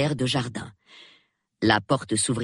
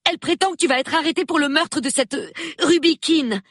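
high, fast, agitated woman's voice; upset young woman; 4 dB louder, faster than usual. A narrator's reading. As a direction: rattle it off, alarmed.

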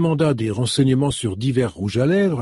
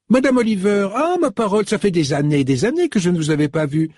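high, effusive welcome; delighted older woman; 7 semitones higher than usual. A narrator's reading. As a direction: greet an old friend with a burst of joy. Narrator voices Mrs Buckle, overjoyed.

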